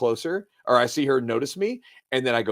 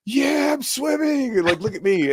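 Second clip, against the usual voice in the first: put-on much higher, slow boastful kid's cheer; proud kid; 13 semitones higher than usual. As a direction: crow with childish pride, drawn out. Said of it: "and I'm like".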